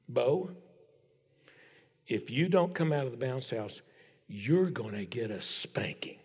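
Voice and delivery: low, hushed, ominous warning with long pauses; big-guns threat. Retold or imitated. Dad warns his son in the bounce house, quiet and deadly serious. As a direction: drop almost to a whisper on the threat. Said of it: "pulls out the big guns".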